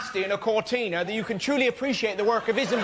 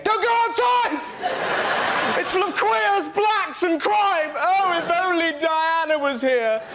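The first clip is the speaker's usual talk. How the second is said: high, loud scaremongering wail; hysterical horror; 7 semitones higher, 5 dB louder, slower than usual. Acted. Daily Express warning readers, hysterical horror. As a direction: shriek headlines in mock panic. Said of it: "unremitting horror of the Daily Express".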